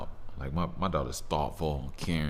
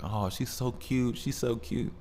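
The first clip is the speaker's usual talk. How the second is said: a hint of gushing onlookers, higher; lightly voiced strangers fawning over the floating baby; cooing onlookers; 8 semitones higher than usual. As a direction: coo over a cute baby, slowly. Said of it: "everybody's like".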